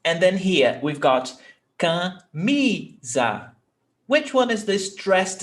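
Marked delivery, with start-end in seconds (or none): 1.8-3.5 camisa slowly split into ca, mi, za; slow, separated ca-mi-za; syllable by syllable. Shown as a demonstration. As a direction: stretch camisa out in three separate beats. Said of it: "how many syllables have we got".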